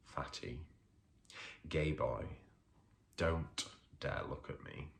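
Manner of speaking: normal talking voice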